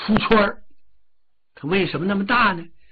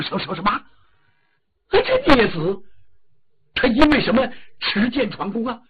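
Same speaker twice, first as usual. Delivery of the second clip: startled angry king; 6 semitones higher, 4 dB louder than usual. Acted. King hears son storms palace.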